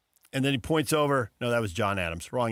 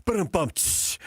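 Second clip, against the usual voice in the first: imitated rimshot; 4 semitones higher than usual. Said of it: "And I was like".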